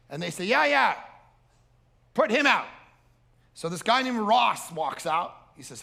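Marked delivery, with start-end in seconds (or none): 0.4-2.7 loud, high call from the garage; calling back. Retold or imitated. Gas station worker yelling back to the stranger, loud and high. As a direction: shout it back across the station.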